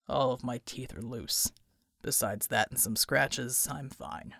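low, slow gruff voice; deep slow man's voice. A narrator's reading. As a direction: drop low and slow for Bulwark. Bulwark's character voice.

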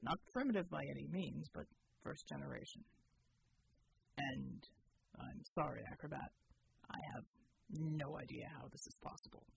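low, steady soldier voice; deep measured military man; Bull's voice. Acted. Soldier Bull reporting to the team, low and steady.

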